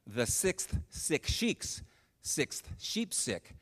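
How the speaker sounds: very slow and soft, word by word; careful tongue twister. Retold or imitated. Missionary corrects the guide with a harder twister, slowly and carefully. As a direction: pick through the tongue twister slowly and quietly, with pauses.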